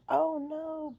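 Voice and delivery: high, loud startled cry; mock fright. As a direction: yelp in alarm. Goldilocks sees the bears.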